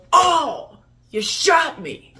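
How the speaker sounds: loud, high opening, then slow complaint; mock wounded cry. D'Artagnan after being shot, hammed-up wounded yell. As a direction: cry out like an indignant victim. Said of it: "D'Artagnan goes".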